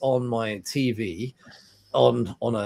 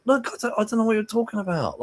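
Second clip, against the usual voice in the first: much higher, fast defensive protest; retold protest; 11 semitones higher, faster than usual. Husband retelling denying it to his wife, high fast protest. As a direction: protest your innocence, high and quick.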